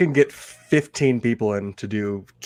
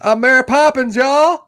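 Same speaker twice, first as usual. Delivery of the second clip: much higher, loud and gleeful; high-pitched delighted shout; high quote voice; 12 semitones higher, 6 dB louder than usual. Acted. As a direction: squeal it out like the movie line. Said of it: "best scene of the movie".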